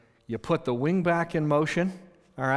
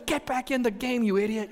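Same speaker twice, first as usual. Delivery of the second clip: scolding coach; 9 semitones higher than usual. Retold or imitated. Coach scolds excited player.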